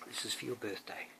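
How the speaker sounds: ordinary delivery, nothing marked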